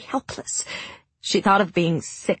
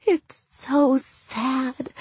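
slow, higher, tear-choked voice; tearful; 7 semitones higher, much slower than usual. A narrator's reading. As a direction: speak through tears, drawn out. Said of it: "words muffled by tears".

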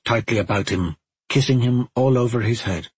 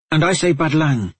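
higher-pitched, insistent retort; mildly irritated comeback, pitch raised; snapping back; 7 semitones higher, 3 dB louder than usual. A narrator's reading. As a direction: snap it back as a firm correction. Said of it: "Owlin snapped back".

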